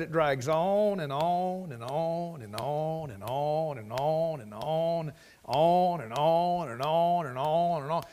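slow, endless repeating of on and on; drawn-out repetition. Shown as a demonstration. Preacher showing tiresome repetition to the church, slow endless drone. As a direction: drag it out until it wears the listener down. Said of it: "what if I got up and said".

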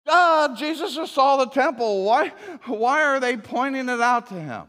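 high, loud disbelief; can't believe what they did; incredulous. Real, not acted. Incredulous rant about the disciples.

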